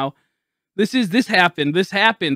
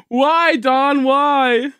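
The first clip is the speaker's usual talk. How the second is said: anguished plea; 5 semitones higher, 4 dB louder, much slower than usual. Real, not acted. Lamenting the game mishap.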